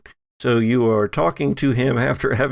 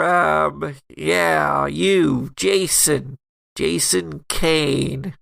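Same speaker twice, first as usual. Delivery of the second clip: nasal voice; 4 semitones higher, much slower than usual. Acted.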